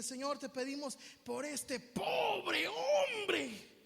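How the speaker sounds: mock wailing prayer, drawn out and swelling loud; hammed-up, slow wail on "pobre hombre"; pitying prayer. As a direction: pray over someone with exaggerated pity. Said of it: "a veces tratamos a la gente así".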